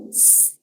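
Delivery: a long hissed S with no voice; voiceless S. Shown as a demonstration. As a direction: hiss the S to feel for vibration.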